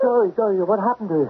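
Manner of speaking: distraught. Acted. Distraught at her collapse.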